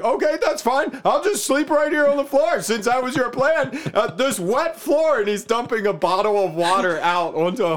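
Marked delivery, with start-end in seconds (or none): 0.0-5.2 high, mock-resigned husband voice; sarcastic husband. Husband to wife, high sarcastic surrender. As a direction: pretend to give in, dripping sarcasm.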